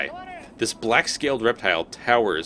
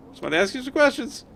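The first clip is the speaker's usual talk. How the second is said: much higher, fast squeaky pleading; mock high voice; faster than usual. Player pleads with the fiend, mock high squeak.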